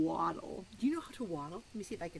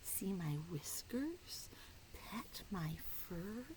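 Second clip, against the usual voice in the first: secret whisper; 7 dB quieter than usual. Acted. Cat riddle whispered to children.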